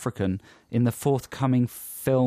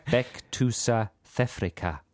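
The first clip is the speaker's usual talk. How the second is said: South African accent; 4 dB quieter, slower than usual. Shown as a demonstration. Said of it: "you're doing it as well".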